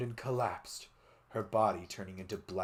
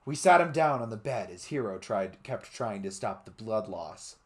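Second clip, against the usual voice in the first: usual speaking voice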